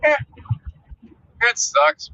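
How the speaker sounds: high, breezy "eh, whatever" tone; body shrugging it off. Retold or imitated. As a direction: shrug it off like nothing matters. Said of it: "'Cause I'm just like".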